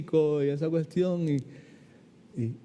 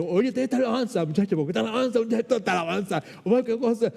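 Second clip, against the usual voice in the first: higher, animated mock enthusiasm; excited exclaiming; 6 semitones higher, faster than usual. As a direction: hype up the worship song. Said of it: "y yo decía". (exclamación entusiasta, más aguda, imitándose a sí mismo)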